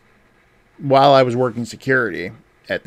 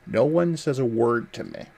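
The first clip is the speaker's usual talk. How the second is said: usual speaking voice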